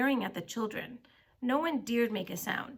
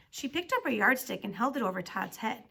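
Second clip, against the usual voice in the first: usual speaking voice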